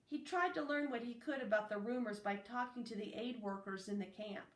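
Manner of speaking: ordinary delivery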